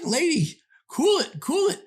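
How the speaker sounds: lady cool it. Retold or imitated. Bad Boy calming the woman.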